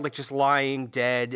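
ordinary talk, nothing marked

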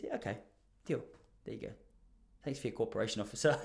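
soft, low, breezy let-go; easy as you like, dropped low and quiet; offhand agreement. Retold or imitated. Uncle casually obliging the officers, low and offhand.

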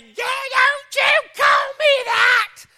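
very high, slow mock shriek; outraged neighbor mom. Mimicked mother scolding son for name-calling, outraged shriek. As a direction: stretch each word in a loud, piercing imitation. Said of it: "he called his mom Butthead".

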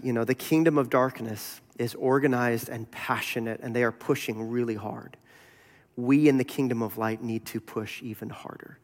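low, soft, solemn retelling; grave warning. Retold or imitated. Bonhoeffer warning the visitor on the hilltop, quietly grave.

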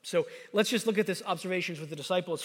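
ordinary delivery

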